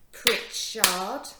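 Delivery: PRIT-CHARD in two loud beats; split into syllables. Shown as a demonstration. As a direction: break "Pritchard" into two strong parts. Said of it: "break it up into two parts".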